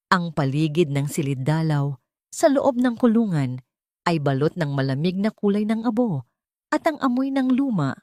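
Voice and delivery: usual speaking voice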